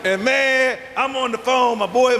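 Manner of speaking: usual speaking voice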